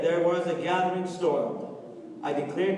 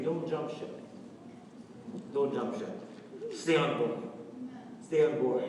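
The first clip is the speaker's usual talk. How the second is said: gentle exhortation; 4 semitones lower, 6 dB quieter than usual. Acted.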